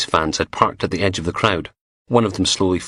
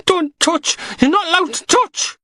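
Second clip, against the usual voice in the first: panicked shriek. Acted. Knox panicking as Logan grabs his sleeve, high terrified wail. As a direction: shrink back in terror and cry it out.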